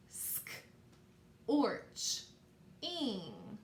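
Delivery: "Sk, orch, ing" with long pauses between parts; word in parts. Shown as a demonstration. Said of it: "as we say those parts".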